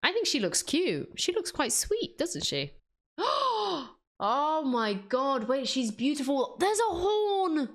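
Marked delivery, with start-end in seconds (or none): 3.2-3.9 a high, real gasp of delight. Real, not acted. Delighted at reference picture. 4.2-7.7 higher, slow, delighted discovery; excited. Real, not acted.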